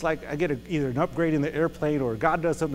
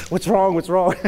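eager, louder worried question; worried host; 3 semitones higher, 9 dB louder than usual. Retold or imitated. Brother Timothy fussing over the pastor, eager and louder. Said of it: "he's like".

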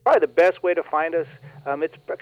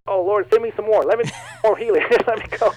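higher, louder, laughing mock plea; eager plea; 5 semitones higher, 5 dB louder than usual. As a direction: act out an eager prayer through a smile. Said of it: "when you're just thinking about".

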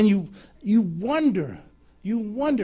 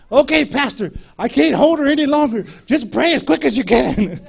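high, loud mock plea under strain; strained urgent shout; 7 semitones higher, 11 dB louder than usual. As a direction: shout it while struggling to hold someone. Friend struggles to hold patient.

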